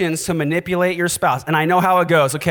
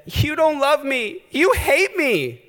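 high, slow mock-tearful plea; tearful wife; 9 semitones higher, slower than usual. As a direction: whine it like a wife in tears. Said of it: "came to him in tears and said".

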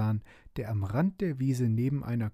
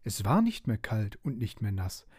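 plain reading voice

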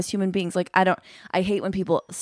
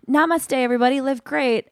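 lightly mocking, higher-pitched cheery greeting; mock yoga influencer; 8 semitones higher than usual. Mimicking the yoga chick's posts to followers, mock influencer voice. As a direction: parody a blissed-out wellness greeting.

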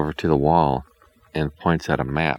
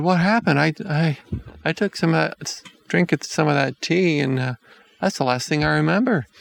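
put-on confused boy voice; 11 semitones higher than usual. A narrator's reading. Hansel confused after waking.

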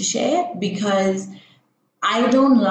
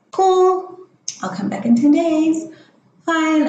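high, sing-out mock acceptance; put-on agreeable reply; 9 semitones higher, 4 dB louder than usual. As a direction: go high as if playing along with the doctor. Acting out her compliant replies to doctor.

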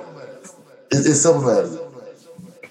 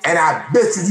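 ordinary talk

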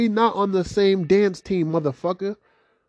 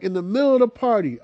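ordinary delivery

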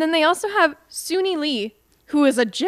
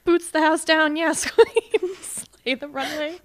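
mock sassy hype; 4 semitones higher, slower than usual. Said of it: "What are you on right now?".

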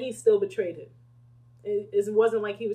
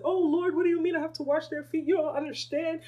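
higher, laughing complaint to the Lord; whiny disciple; 9 semitones higher, 6 dB louder than usual. Pretend. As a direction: whine like a spoiled disciple refusing the chore. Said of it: "So this should be no reason".

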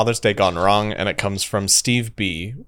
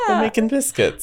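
cutesy high voice; 10 semitones higher than usual. Acted. Cute cat voice.

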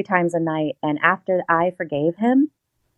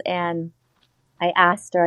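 ordinary talk

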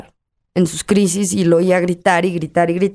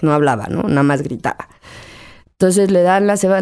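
ordinary delivery

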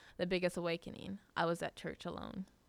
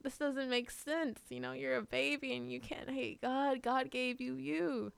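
bewildered protest; 6 semitones higher than usual. Retold or imitated. Retold bewildered pleading with little sister.

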